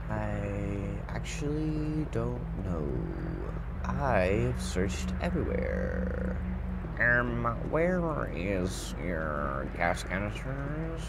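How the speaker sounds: very slow, stretched-out words; drawn-out stalling. Pretend. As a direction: drag every word out while searching. Stalling while searching the game.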